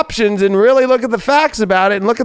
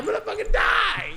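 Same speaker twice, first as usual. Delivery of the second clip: very high, softer wail; mock despair; 7 dB quieter than usual. Reader upstaged by Craig, high mock-dying wail. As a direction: whimper it high in comic agony.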